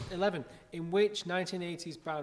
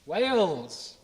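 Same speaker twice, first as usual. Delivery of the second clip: stray Irish-tinged Wales; slipped accent; 7 dB louder than usual. Real, not acted. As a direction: drift into another accent. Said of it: "Got me accent".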